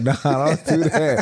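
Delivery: laughing dismissal. Retold or imitated. Laughing off the accent idea.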